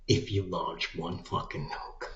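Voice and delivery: ordinary delivery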